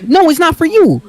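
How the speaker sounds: very high-pitched protest; indignant refusal. Retold or imitated. Boy snatching his snack back, indignant refusal. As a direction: refuse in a high, hammed-up huff.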